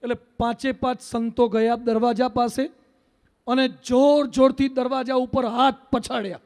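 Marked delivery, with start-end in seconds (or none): none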